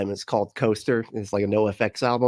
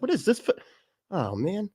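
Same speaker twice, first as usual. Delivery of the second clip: puzzled; 9 semitones higher, 4 dB quieter than usual. Retold or imitated. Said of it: "you're like".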